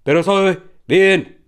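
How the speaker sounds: ordinary talk, nothing marked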